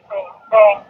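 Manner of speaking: two high, ringing dings; high chiming bell noise; imitated church bell. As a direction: mimic a distant bell striking the hour. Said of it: "it went ding".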